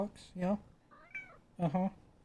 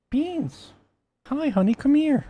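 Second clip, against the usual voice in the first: high calling to the cat; pet-talk coaxing; 11 semitones higher, 10 dB louder, slower than usual. Real, not acted. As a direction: call the cat sweetly, much higher.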